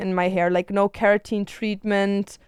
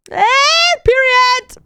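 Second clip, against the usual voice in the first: sassy; 8 dB louder, much slower than usual. Real, not acted. Sassy exclamation.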